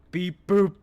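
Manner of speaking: high loud robot beeps. Acted. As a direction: tease with a robot voice. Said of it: "Shut up".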